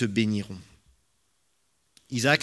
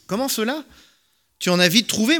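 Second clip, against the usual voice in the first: put-on surprised old father; 10 semitones higher, 5 dB louder than usual. A narrator's reading. Isaac suspicious at quick return.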